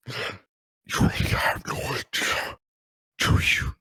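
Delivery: slow, gravelly whispered threat; Arkham Knight voice. Acted. Arkham Knight holding a gun behind Batman, whispered threat. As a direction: menace him from behind, slow. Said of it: "I'm gonna do the whole scene".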